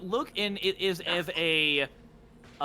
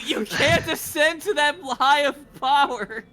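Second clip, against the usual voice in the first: booming villain; 9 semitones higher, 7 dB louder than usual. Acted. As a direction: proclaim it with menace. GM voices villain.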